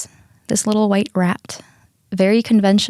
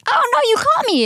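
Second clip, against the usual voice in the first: very high squeal of being caught; mock alarm. Runaway dog spots owners, what he didn't do, squealed alarm. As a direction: voice a guilty runaway in squeaky panic.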